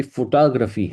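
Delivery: فوٹوگرافی as fo-TAA-gra-fee; English pronunciation. Shown as a demonstration. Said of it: "اگر کوئی دوبارہ سنیں". (فوٹوگرافی کو انگریزی انداز میں "فوٹاگرفی")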